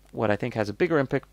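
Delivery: normal talking voice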